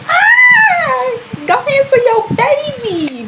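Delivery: very high, loud wail; high-pitched fangirl-style cry; mock exasperated squeal. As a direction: squeal it out in hammed-up urgency. Reacting in mock frantic squeal.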